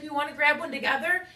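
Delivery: put-on high, quick, friendly voice of the other girl; friendly girl's offer. Stranger girl offers company.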